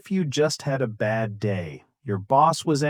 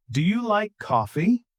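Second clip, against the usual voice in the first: "coffee" lifted at the end, higher voice; rising intonation; 5 semitones higher than usual. Shown as a demonstration. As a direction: ask it with "coffee" going up. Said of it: "Time for another repeat after me".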